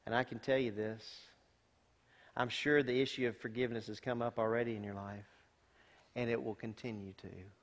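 soft, low, sincere; quiet heartfelt word to the youth; tender appeal. Real, not acted. Pastor turns to youth softly.

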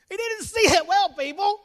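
high, loud gleeful repeat; gleeful punchline. Real, not acted. Dad crowing his joke to the family. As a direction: crow the punchline at them.

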